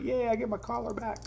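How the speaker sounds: high, soft happy dog voice; voicing the dog. Owner voicing his dog getting his collar back, high and soft. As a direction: speak for the excited pup in a high, hushed little voice. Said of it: "He was like".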